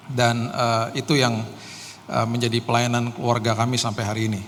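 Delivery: ordinary talk, nothing marked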